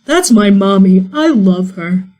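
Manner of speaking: a hint of a happy dog; lightly voiced dog. GM voicing Barkley to Zapala, lightly voiced dog. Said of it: "Barkley looks at Zapala and goes".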